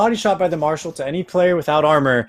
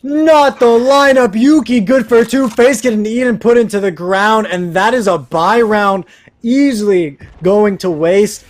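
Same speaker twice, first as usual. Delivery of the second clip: excited; 6 semitones higher, 6 dB louder than usual. Real, not acted. Caster reacts to double kill.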